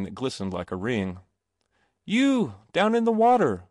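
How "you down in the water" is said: high, loud call down to the water. A narrator's reading. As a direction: call down from the bridge. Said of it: "he called".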